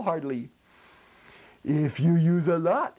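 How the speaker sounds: ordinary talk, nothing marked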